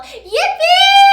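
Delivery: high, very loud joyful shout; yell. A narrator's reading. Elf yelling yippee on Christmas morning. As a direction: yell it with all your might. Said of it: "wake up early each morning and yell".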